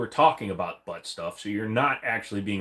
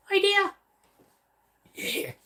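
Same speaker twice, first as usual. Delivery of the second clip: squeaky cry; slower than usual. Pretend. To viewers, as his idea bulb lights up, very high squeal. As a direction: squeak it out like a cartoon lightbulb going on.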